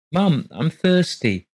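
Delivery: put-on high, slow child's whine; a small boy calling. A narrator's reading. Child calls from bed.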